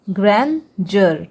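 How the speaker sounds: ग्रैंडर said slowly as "gran-jur"; DEUR read as jar. Shown as a demonstration. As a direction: split ग्रैंडर in two, ending in a j sound, "jur". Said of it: "डीईयूआर को हम जर पढ़ रहे हैं". (ग्रैंडर को धीरे से ग्रैन-जर बोलकर)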